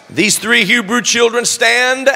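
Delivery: "stand" pressed hard at the end; children "stand", emphasised; stress on stand. Shown as a demonstration. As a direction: land firmly on "stand". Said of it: "again, emphasis on the word stand".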